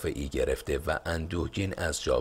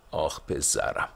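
ordinary delivery, nothing marked

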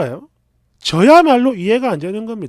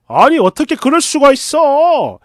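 louder, higher, drawn-out protest; acted outraged boyfriend, raised voice; indignant scolding; 5 semitones higher, 6 dB louder than usual. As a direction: scold in open disbelief, slow and high. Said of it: "아예 대놓고 뭐라 뭐라".

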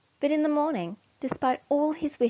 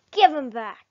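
louder demand; angry yell; 4 semitones higher, 8 dB louder than usual. A narrator's reading. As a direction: bark it back at them. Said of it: "yell the other animals".

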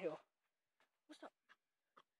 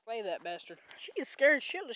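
ordinary talk, nothing marked

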